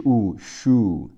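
"呜" held as a long oo, then blended into "shoo"; long oo sound. Shown as a demonstration. Teacher sounding out double O for learners, long drawn "shoo". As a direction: draw out the oo in "呜" and "shoo". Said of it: "两个O读长音呜".

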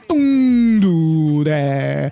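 long, drawn-out Chinese-accented "dong du de"; mock-accented "don't do that". Shown as a demonstration. Host telling learners off, drawn-out mock Chinese accent. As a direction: stretch each syllable comically. Comic scolding of bad reading.

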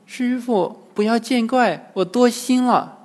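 high-pitched maiden's voice; young girl. A narrator's reading. Disguised demon apologises to the monk, young girl's voice.

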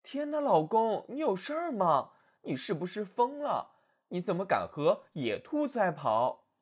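high, worried wife voice; anxious wife. Acted. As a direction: fret at your husband in alarm. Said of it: "焦虑地走来走去".

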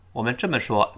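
usual speaking voice

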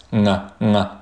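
normal talking voice

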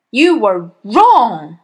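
loud, slow, drawn-out verdict; barked. A narrator's reading. Headmistress condemns the boy's spelling, barking. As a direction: boom out each word, swooping on WRONG. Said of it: "the Trunchbull barked".

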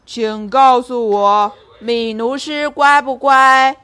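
loud, slow lion asking; a big lion leaning in to ask; booming circus lion. Acted. Lion questions the clowns.

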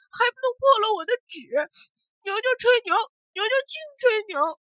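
high, loud sniffling complaint; after crying. Acted. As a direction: sniffle through the tattling. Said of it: "小刚抽着鼻子说".

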